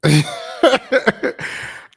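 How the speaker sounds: loud, high burst of laughing; real laughter. Real, not acted. Storyteller seeing his old photo, loud high laughter. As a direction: crack up at your younger self.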